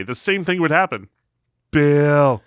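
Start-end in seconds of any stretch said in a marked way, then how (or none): 1.7-2.4 loud, long, higher-pitched name call; imitated former boss. Boss phoning again next Saturday, loud drawn-out imitation. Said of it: "he used to talk like this".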